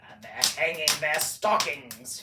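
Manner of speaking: loud, sneering snarl; snarling Grinch. A narrator's reading. The Grinch sneering at the Whos, loud snarl. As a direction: spit it out with a sneer. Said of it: "he snarled with a sneer".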